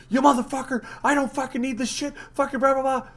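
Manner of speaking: high-pitched retold angry tirade; mock drill-sergeant outburst; drill sergeant rant. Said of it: "like a drill sergeant".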